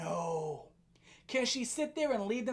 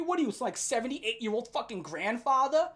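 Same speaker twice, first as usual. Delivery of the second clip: ordinary talk, nothing marked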